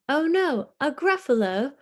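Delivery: high, loud alarmed cry; fox crying out. Acted. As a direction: shriek it like a startled fox. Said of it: "cried the fox".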